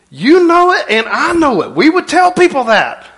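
high, loud preacher's cry; loud preaching. Real, not acted. Pastor calling out to the church, loud. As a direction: lift it up and drive it home to the congregation. Preacher rising to full force.

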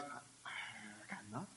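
breathy near-silent whisper; whispered despair. Retold or imitated. Pastor mimicking a hopeless prayer, hushed whisper. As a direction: whisper it like a defeated pray-er.

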